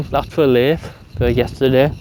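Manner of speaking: normal talking voice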